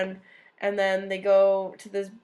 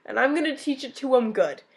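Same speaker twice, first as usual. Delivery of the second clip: louder, cocky boast; mock cocky Napoleon; 3 semitones higher, 5 dB louder than usual. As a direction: puff up like a cocky conqueror. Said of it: "he was like".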